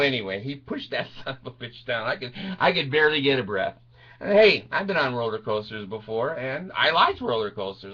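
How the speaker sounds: ordinary talk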